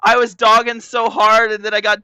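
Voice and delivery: fast, high, loud frustrated rant; excited outburst. Real, not acted. Losing first place at the end, loud outburst. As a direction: blurt it out in disbelief.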